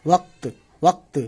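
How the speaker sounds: "وقت" in one syllable, ending straight on k-t; clipped final consonants. Shown as a demonstration. Teacher showing learners how the word ends, "وقت" pronounced waqt. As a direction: say "وقت" as waqt, no vowel between q and t. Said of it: "تے پہ آواز ختم کر دینی ہے".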